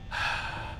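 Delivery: soft, breathy sigh; weary sigh. Real, not acted. Player running low on power, quiet sigh. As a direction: let out a quiet sigh of defeat. Losing at the game.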